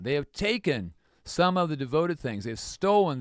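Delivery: ordinary talk, nothing marked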